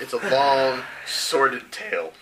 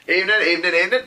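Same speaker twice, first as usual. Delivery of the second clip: louder, higher hail to the group; breezy greeting; 6 semitones higher, 5 dB louder, much faster than usual. Acted. Mara walks over to these guys, breezy loud greeting. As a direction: greet everyone in passing.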